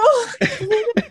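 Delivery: high laughing giggle; a tearful giggle; rising laugh. Real, not acted. Recalling crying on the phone, high laugh.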